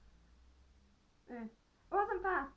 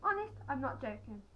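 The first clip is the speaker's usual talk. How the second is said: plain reading voice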